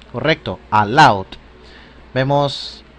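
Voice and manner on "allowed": "allowed" with OU as one glide; joined vowel demo. Shown as a demonstration. Teacher confirms the verb for learners, "allowed" with joined vowels. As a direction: say "allowed" as one clear model word. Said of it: "se dice unida".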